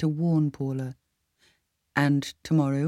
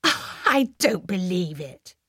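slow, laughing, amused disbelief; 3 semitones higher, much slower than usual. A narrator's reading. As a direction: laugh it off, stunned.

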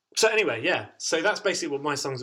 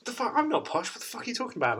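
high-pitched indignant protest, half joking; mock defensive outburst; 11 semitones higher, faster than usual. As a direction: bristle like you've been insulted, playfully. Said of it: "Totally defensive".

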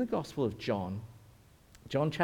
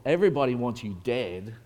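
normal talking voice

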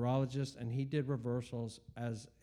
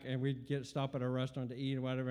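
normal talking voice

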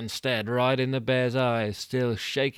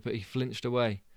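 ordinary delivery, nothing marked

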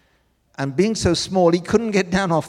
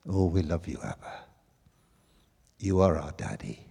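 tender prayer; 11 semitones lower, 5 dB quieter than usual. Real, not acted. Turns to prayer.

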